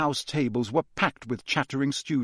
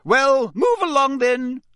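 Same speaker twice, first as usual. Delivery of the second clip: high, loud, slow command; sharp raised-voice instruction; sharp order; 12 semitones higher, 7 dB louder, slower than usual. A narrator's reading. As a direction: briskly order a crowd onward as a stern teacher. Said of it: "said Professor McGonagall sharply".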